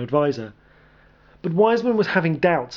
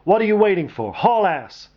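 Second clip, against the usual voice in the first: impatient shout; 7 semitones higher, 4 dB louder than usual. A narrator's reading. Commander shouting at soldiers.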